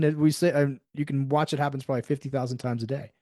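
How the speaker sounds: usual speaking voice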